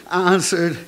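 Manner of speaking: plain reading voice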